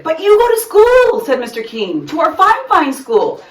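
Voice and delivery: high, loud, startled principal; taken aback by the girl; surprised principal. A narrator's reading. Mister King surprised.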